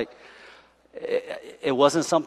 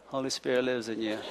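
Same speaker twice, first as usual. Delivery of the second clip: barely voiced, soft and laughing, mocking the lazy crowd; lazy mumble; 5 semitones lower, 14 dB quieter than usual. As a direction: mumble it lazily without turning your head. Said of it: "You guys are like this".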